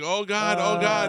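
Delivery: high, loud horrified oh God; aghast oh God. Retold or imitated. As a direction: gasp it out in disbelief. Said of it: "And I was like".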